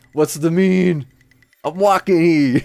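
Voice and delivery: loud, high hammed-up New Yorker accent. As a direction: holler it like a mock New Yorker. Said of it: "a New Yorker accent".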